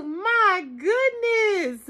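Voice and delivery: slow, laughing "My goodness". Real, not acted. Thrilled by the bag lining, she laughs out her goodness. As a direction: laugh through it, delighted.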